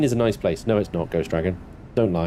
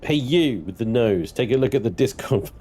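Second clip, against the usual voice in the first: normal talking voice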